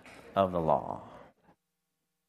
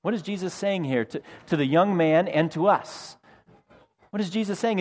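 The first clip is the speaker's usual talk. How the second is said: normal talking voice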